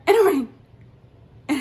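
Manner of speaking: sudden loud high and ringed; exasperated burst. Real, not acted. The scammer never picks up, loud exasperated outburst. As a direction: blurt it out in frustration. Calling number repeatedly, frustration.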